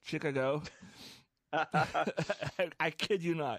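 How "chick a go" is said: "Chicago" said as CHICK-a-go; "Chicago" with a hard CHICK at the front; spelling pronunciation. Shown as a demonstration. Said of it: "how I remember how to spell Chicago".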